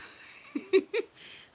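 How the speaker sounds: high, loud breathy laugh; airy high burst of laughter. Real, not acted. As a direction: crack up at your own impression. Laughing at own impression.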